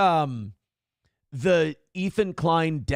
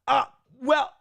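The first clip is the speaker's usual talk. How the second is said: higher, caught-out hesitant "uh, well"; awkward hesitation; 9 semitones higher, 3 dB louder than usual. Retold or imitated. Host caught out by the critics' accusation, awkward and higher. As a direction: stall awkwardly like you've been caught. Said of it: "And you're like".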